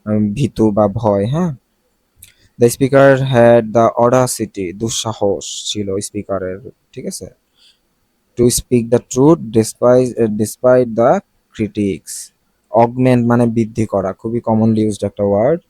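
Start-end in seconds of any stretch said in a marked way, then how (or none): 2.6-4.7 very slow, careful English read; slow example read. Shown as a demonstration. Teacher reading an example sentence to students, very slow. 8.4-12.3 very slow, careful English read; slow example read. Shown as a demonstration. Teacher reading an example sentence to students, very slow.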